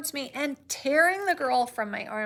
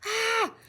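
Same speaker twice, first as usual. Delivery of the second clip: short high mock scream; shrill scream; 11 semitones higher than usual. Child seeing the creature, mock high scream. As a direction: yelp like a frightened child. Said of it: "uttered a shrill scream".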